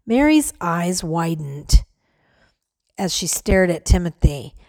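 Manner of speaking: usual speaking voice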